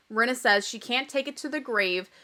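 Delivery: ordinary talk, nothing marked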